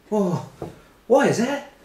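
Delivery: high, squeaky put-on voice; shrill mock falsetto of a confused diner; mock high squeak. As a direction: squeak it out like a baffled kid. Neil voiced in retold anecdote.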